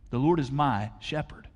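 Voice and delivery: intimate. Real, not acted. Pastor to congregation, soft and sincere. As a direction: draw them in quietly.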